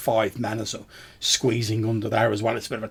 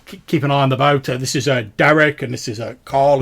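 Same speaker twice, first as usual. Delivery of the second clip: put-on loud, raised voice greeting old acquaintances; hearty ferryman; 3 semitones higher, 8 dB louder than usual. Ferryman meets returning travellers under the eaves, loud and raised. Game master plays the ferryman.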